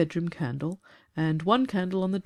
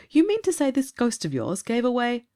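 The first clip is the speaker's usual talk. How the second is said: raised character voice; 6 semitones higher, 4 dB louder than usual. A narrator's reading. Listener interrupts in character.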